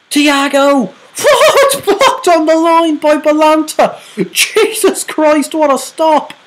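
very high, loud cry through laughter; ecstatic shout. Real, not acted. Reacting to the block on the line, ecstatic laughing shout. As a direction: scream with relief at the block.

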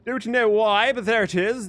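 normal talking voice

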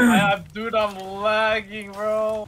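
high loud frustrated gamer whine, slow; hyped gamer bro. Real, not acted. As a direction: drag out the lag complaint. Lagging during the base raid.

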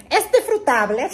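laughing through the words, loud and high. Real, not acted. Joking about his British accent, laughing. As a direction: crack up mid-sentence.

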